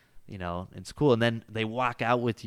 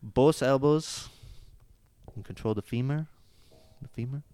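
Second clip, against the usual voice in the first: Brazilian accent; 5 semitones higher than usual. Shown as a demonstration. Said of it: "teach it with the accent".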